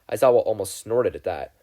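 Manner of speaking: ordinary delivery, nothing marked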